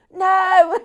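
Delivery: big high holler; shouted call. Retold or imitated. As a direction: yell the word out long to upstairs. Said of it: "person down here shouts".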